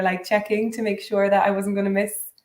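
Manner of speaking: ordinary talk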